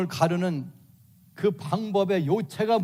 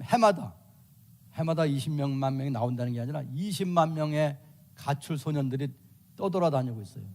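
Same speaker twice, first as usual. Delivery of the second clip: normal talking voice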